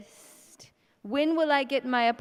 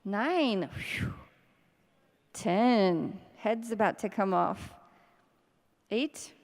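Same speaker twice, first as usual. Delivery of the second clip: usual speaking voice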